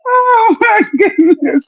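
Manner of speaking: laughing. Real, not acted. Laughing reaction.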